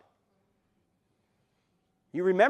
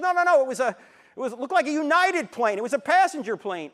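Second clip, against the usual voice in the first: higher, louder, alarmed rush; shocked pastor; 7 semitones higher, 6 dB louder than usual. Retold or imitated. Pastor on the phone reacts in alarm to the second plane, loud and high. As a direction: blurt out what he just saw.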